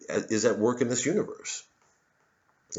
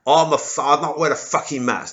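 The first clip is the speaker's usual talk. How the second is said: Cockney; 4 semitones higher, 4 dB louder than usual. Retold or imitated. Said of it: "if a Cockney".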